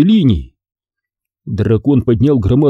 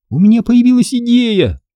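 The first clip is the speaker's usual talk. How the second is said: high-pitched, breathless-with-excitement interjection; excited interruption; 11 semitones higher than usual. A narrator's reading. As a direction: cut in excitedly, pitched well up. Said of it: "взволнованно дыша". (взволнованно перебивая, высоким голосом)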